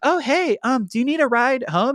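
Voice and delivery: high, sweet offering voice; a hint of a sweet girl asking; lightly voiced girlfriend. Mackenzie offering Nicholas a ride, high and sweet.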